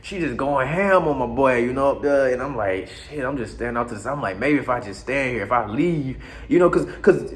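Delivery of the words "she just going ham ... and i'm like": usual speaking voice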